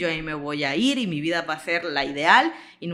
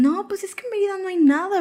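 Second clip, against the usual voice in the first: mocking Morelia locals; 13 semitones higher, 4 dB louder than usual. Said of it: "Y me dicen".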